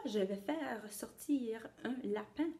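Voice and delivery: secretive stage whisper. Acted.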